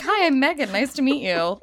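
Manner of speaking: high, bright mock-polite introduction; chirpy polite greeting. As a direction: introduce yourself like a stranger in a store.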